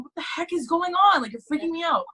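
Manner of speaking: high-pitched, mildly panicked protest; retold freaking out. Daughter to crying mom, high mock alarm. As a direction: act out rising alarm, voice up high.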